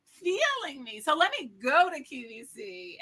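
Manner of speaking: excited realization. Retold or imitated. Continuing her own sudden idea.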